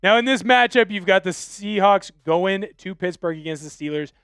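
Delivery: normal talking voice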